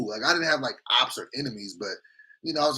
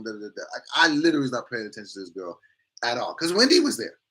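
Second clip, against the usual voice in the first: ordinary talk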